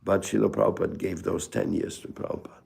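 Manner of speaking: usual speaking voice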